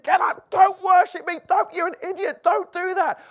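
high, loud mock scolding; alarmed refusal. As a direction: shout them off in acted exasperation. Acts Paul and Barnabas refusing worship.

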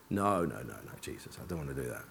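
very low, hushed mock refusal; reluctant pleading. Driver answering Jesus after losing his temper, hushed reluctance. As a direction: plead under your breath, acting it out.

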